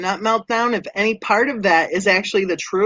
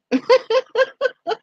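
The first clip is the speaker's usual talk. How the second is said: high laugh. Real, not acted. Laughs about Dee.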